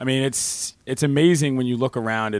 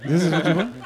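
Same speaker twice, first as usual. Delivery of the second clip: hammed-up French accent, higher and louder; 6 semitones higher, 6 dB louder than usual. Shown as a demonstration. Guest showing off the accent to the host, mock French accent. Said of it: "I speak with a pretty good French accent".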